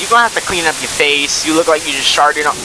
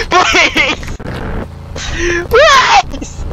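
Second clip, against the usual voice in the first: frantic pleading; much slower than usual. Real, not acted. Gamer chased by Granny, begging for mercy in high panicked shouts. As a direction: beg for your life, half laughing.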